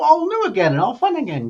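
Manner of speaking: ordinary delivery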